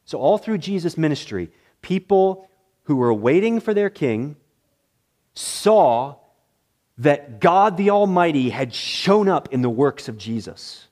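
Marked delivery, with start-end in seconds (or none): none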